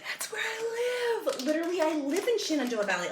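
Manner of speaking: high, stunned squeal of recognition; delighted surprise. Real, not acted. Vlogger spots her own valley on the label, squealing in surprise. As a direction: squeal as if you found your hometown.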